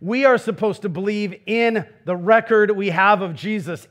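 ordinary talk, nothing marked

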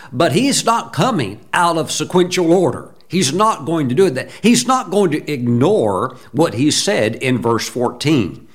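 normal talking voice

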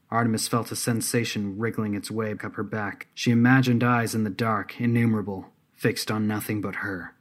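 ordinary delivery

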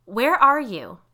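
insistent shout through the house; demand. A narrator's reading. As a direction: demand an answer, calling out. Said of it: "this time it came out as a demand".

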